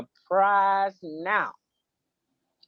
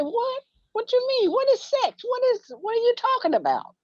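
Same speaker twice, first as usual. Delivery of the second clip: much higher, bewildered squeal; shocked kid. Retold or imitated. Sixth grader baffled by the principal's accusation, high and rushed. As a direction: total disbelief, pitch soaring. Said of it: "I said".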